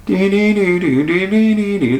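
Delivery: high sing-song "dee dee dee" of bafflement; dazed dee-dee babble. Retold or imitated. Host miming his stunned thoughts in a high babble. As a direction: babble like your brain just stalled. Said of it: "And I'm thinking".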